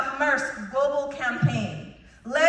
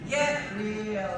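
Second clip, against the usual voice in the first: put-on bored teenager, lower and softer; mall rat; 5 semitones lower, 6 dB quieter than usual. Shown as a demonstration. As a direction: sigh it out like a bored teen. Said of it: "say it like a very bored teenager".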